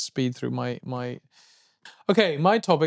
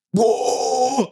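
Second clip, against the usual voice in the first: sudden high growled whoa; grindcore roar; 8 semitones higher than usual. Retold or imitated. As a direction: bark it like a grindcore singer. Mimicking grindcore vocals.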